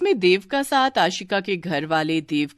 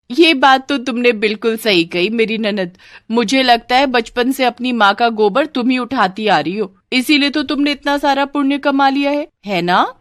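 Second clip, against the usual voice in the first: raised, sweetly taunting bahu; sarcastic daughter-in-law; 5 semitones higher, 4 dB louder than usual. Acted. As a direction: praise the nanad with a sting. (Tana Maruti बहू)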